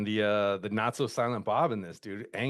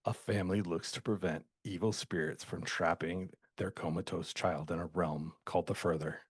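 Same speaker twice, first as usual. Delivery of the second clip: low, soft synopsis read; IMDb voice; 5 semitones lower, 6 dB quieter than usual. Acted. Podcaster reading the movie database synopsis, low put-on voice. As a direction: put on a hushed, low official summary voice. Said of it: "put on my I M D B voice".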